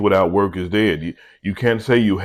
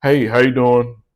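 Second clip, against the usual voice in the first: friendly greeting; 3 semitones higher, 4 dB louder than usual. Shown as a demonstration. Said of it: "but just".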